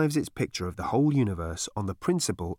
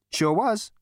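cocky Zaphod voice; 10 semitones higher than usual. Acted. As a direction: play it cool and cocky.

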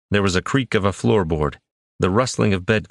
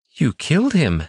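put-on stunned boy; 8 semitones higher than usual. A narrator's reading. Shocked boy.

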